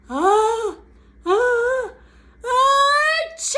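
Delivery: loud rising "ah, ah, ah" into a huge sneeze; big acted achoo; building bear sneeze. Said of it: "a small pepper flake makes the bear".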